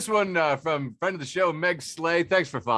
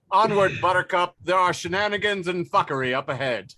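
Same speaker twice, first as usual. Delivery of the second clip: rallying cry; 5 semitones higher, 6 dB louder than usual. Acted. Caption read as rallying cry.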